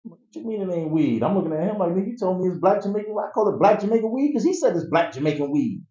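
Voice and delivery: mock outraged insisting; indignant protest. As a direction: argue back high and quick, baffled. Confused protest to friend.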